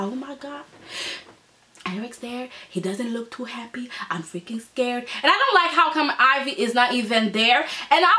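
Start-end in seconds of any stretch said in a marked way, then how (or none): 0.0-5.0 hushed, scared mock whisper of Pam; imitating a frightened secretary, much softer.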